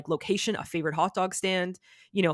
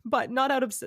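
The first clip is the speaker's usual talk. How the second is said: a little choked up; 8 semitones higher, faster than usual. Real, not acted. Son remembering his late dad to the host, real, slightly choked up. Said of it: "Getting a little choked up".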